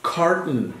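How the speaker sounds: "Carton" said KAR-tn, the O swallowed; English "Carton" with the O gone. Shown as a demonstration. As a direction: drop the O in "Carton". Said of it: "como si no existiera la O".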